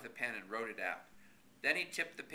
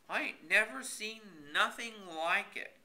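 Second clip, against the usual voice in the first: put-on raised, exasperated country old man; exasperated Horace; 10 semitones higher, slower than usual. A narrator's reading. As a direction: scold him in mock disbelief.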